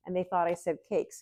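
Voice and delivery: normal talking voice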